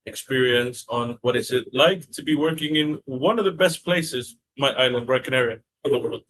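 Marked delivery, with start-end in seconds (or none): none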